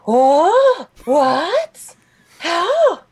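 put-on high, loud bewildered old lady; exaggerated high-pitched confusion; baffled grandma impression. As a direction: squawk it like a mystified grandmother.

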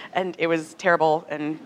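ordinary delivery

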